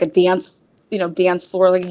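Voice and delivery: usual speaking voice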